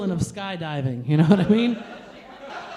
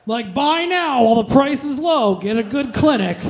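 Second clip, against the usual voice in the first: louder ad-style sales pitch; salesman pitch; 4 semitones higher, 4 dB louder than usual. Retold or imitated. Date hard-selling herpes to her, in a loud ad voice. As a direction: sell it like a TV ad. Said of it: "like a used car salesman".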